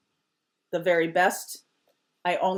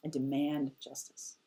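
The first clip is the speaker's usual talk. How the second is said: softer, low and firm; quiet demand; 4 semitones lower, 10 dB quieter than usual. Shown as a demonstration. Demanding justice in a quiet menacing voice. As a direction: say it quiet and cold. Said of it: "He goes really quiet".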